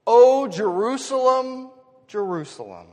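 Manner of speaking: loud, high drawn-out call, then dropping; longing cry. Acted. Caring father calling to his children, in loud longing. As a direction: cry out the name like an aching parent. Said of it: "Do you hear the longing of a".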